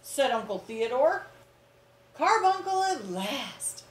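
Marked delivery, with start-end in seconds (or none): 2.1-3.8 high, slow wistful sigh of relief; slow high hushed relief; breathed. Acted. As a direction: breathe it out slowly like a relieved aunt. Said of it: "breathed Aunt Figgy".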